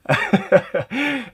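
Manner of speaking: a high laugh at his own slip; tired laugh. Real, not acted. Laughing at himself after a long day, high laugh.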